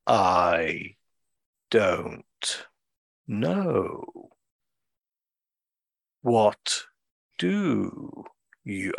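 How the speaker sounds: word by word with very long pauses; slow sloth. Retold or imitated. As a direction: drag out each word like an unhurried sloth. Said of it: "it's quite a slow process".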